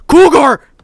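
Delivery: loud, high panicked cry of Cougar!; startled shout. Real, not acted. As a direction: shout the name in sudden alarm.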